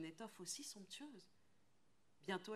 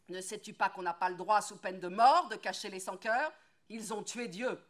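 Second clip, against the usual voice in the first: loud, higher, urgent warning; fearful angry shout; 3 semitones higher, 14 dB louder than usual. Acted. Woodcutter shouting warning about hiding the child. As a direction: shout the danger at her. Woodcutter warns of death penalty.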